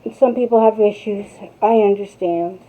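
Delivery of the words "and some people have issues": normal talking voice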